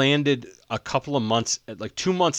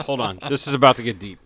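normal talking voice

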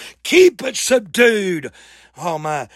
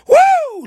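high, loud revival whoop; preacher's whoop; 5 dB louder than usual. Real, not acted. Preacher rousing a church that may not listen, high whoop. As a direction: let out a sudden high holler.

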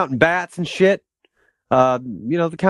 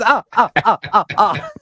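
Count laugh; 5 semitones higher than usual. Retold or imitated.

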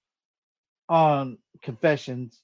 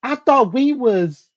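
mock indignant heartbreak; 9 semitones higher, 5 dB louder, slower than usual. Mocking heartbroken boy.

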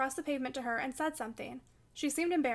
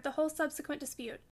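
ordinary delivery, nothing marked

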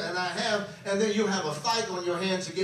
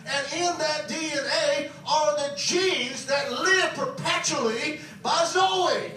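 higher, forceful pulpit proclamation; impassioned preaching; 5 semitones higher than usual. Real, not acted. Preacher tells congregation of their new birth, with rising fervour. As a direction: build to a ringing declaration, drawing out key words.

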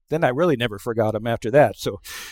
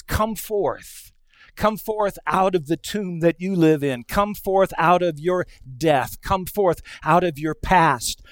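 raised, insistent refrain; higher-pitched, rhythmic exhortation; impassioned preaching; 4 semitones higher than usual. Acted. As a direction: preach each summons with building conviction. Preaching a repeated summons.

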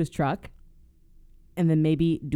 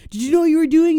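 much higher, much louder hammed-up scream; furious yell; 12 semitones higher, 11 dB louder, faster than usual. Robber with his drilled foot screaming at the plumber, loud mock rage.

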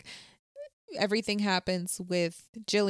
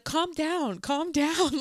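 imitated calming down; 8 semitones higher, much slower than usual. Retold killer telling her to calm down.

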